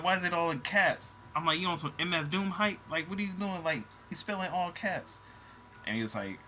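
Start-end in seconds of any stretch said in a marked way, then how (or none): 0.0-5.2 raised-pitch, mildly incredulous questioning; baffled questioning. Retold or imitated. Recounting his reaction to the all-caps post, baffled. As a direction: question it in a higher, puzzled voice.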